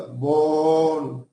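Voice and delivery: "bon" held long on the O; stressed syllable. Shown as a demonstration. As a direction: stretch "bon" out as one sustained stressed syllable. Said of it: "Sílaba tónica".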